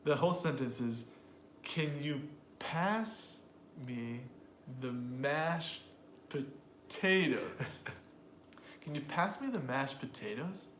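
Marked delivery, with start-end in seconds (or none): 1.6-7.8 slow beats on "pass", "mashed", "potatoes"; long pauses between the beats; exaggerated sentence rhythm. Shown as a demonstration. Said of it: "Every time you hear an emphasized syllable". 8.8-10.7 measured, beat-marked delivery; rhythmic stress demo. Shown as a demonstration. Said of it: "that that is the rhythm".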